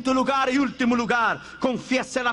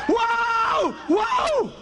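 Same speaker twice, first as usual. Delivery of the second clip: shocked cry; 10 semitones higher than usual. Retold or imitated. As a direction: shout it out in alarm. The man's reaction to falling plane.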